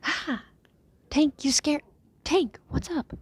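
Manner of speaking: high, startled, panicked; startled scream. Acted. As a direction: jump in fright and call out. Startled by Tank attack.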